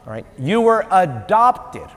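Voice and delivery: high, drawn-out preacher delivery; emphatic preaching. Real, not acted. Preaches the point with force.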